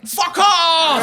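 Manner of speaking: high, loud shout; hammed-up shriek; mock angry yell. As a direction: yell it like an outraged mob. Crowd turns on the caller.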